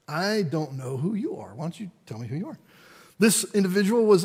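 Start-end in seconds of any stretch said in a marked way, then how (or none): 0.1-2.6 hushed, almost whispered, then fast; low, breathy counter-question; wary reply. Retold or imitated. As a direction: quietly call his bluff.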